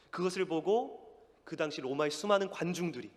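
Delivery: normal talking voice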